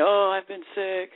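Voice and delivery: slow, put-on sickly whine; mock a singer dodging the request; a light mimic of a singer claiming a sore throat; whiny excuse-making singer. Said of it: "It's like".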